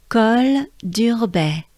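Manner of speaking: "Col dur baie" slowly, French R in dur; French pronunciation demo. Shown as a demonstration. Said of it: "I'm gonna say it once".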